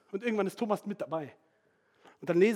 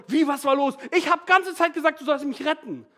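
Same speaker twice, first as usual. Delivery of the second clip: louder, higher, indignant complaint; indignant protest; 6 semitones higher, 5 dB louder than usual. Retold or imitated. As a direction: protest like you were wronged. Drowned man protests to God.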